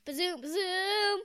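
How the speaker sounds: high, punchy bazoom, bazoom; sound-effect blast on bazoom; excited. Shown as a demonstration. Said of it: "Say it again".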